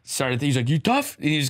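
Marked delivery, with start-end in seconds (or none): 0.7-1.1 taunting question. Retold or imitated. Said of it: "almost asking it as a question".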